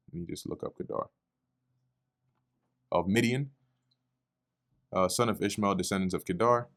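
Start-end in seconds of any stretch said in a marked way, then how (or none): 0.1-1.1 very soft, voiceless murmur to oneself; muttered aside. Real, not acted. Teacher pausing to look up a name, muttering under the breath. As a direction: drop to a near-whisper while checking something. Looking something up mid-lesson, to himself.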